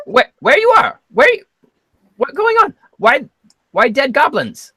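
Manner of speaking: panicked high goblin voice; squeaky, loud, confused goblin. Acted.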